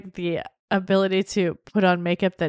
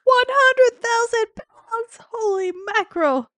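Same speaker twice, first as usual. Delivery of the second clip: very high, slow squeal of shock; mock astonishment; 4 dB louder, slower than usual. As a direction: gasp it out, way up high.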